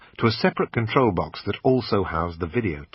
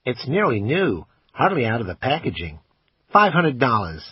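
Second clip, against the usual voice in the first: pawn shop salesman; 3 semitones higher than usual. Acted. Assistant pitching the camera to the customer, salesman voice. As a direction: sell it like a pushy shop assistant.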